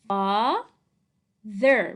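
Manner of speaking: Father stretched loudly into two syllables, fa... ther; sounding out. Shown as a demonstration. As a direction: sound out Father slowly, part by part. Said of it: "Spell and read".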